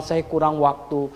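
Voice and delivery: ordinary delivery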